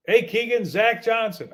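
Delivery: confident, punchy caller introducing himself; good-news tone. Retold or imitated. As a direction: greet him like it's good news. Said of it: "you know, not like".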